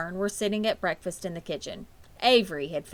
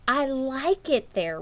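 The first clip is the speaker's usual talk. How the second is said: put-on slow, higher child reply; a hint of a stubborn little girl; lightly voiced girl; 7 semitones higher, 4 dB louder, slower than usual. A narrator's reading. Fern answering her mother, slowly.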